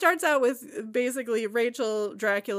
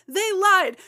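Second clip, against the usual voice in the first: high and loud, falling on lied; hammed-up lament; 10 semitones higher, 8 dB louder than usual. Kitty's first cry of betrayal, high loud falling lament. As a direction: mourn it grandly. Repeated line, building.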